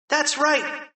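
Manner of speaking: high-pitched boy playing principal; Harold as principal. A narrator's reading. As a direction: chime in high and eager.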